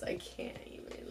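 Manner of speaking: breathless whispered exasperation. Real, not acted. Vlogger whispering she can't cope with the story. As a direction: let it out under your breath.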